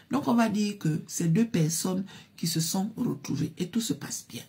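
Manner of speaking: usual speaking voice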